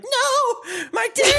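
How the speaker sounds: high mock-anguished cry; mock distress. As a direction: wail it in fake anguish. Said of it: "Hinata being like".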